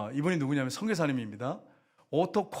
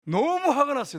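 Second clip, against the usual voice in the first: angry outburst; 10 semitones higher, 6 dB louder than usual. A narrator's reading. Telling missionary's anger.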